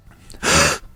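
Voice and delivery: sharp, loud startled gasp. Acted. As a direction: gasp as if jolted awake from a nightmare. Waking from wild dreams.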